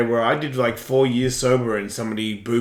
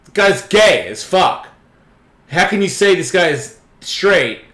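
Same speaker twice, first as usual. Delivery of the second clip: incredulous; 5 semitones higher, 4 dB louder than usual. Retold or imitated.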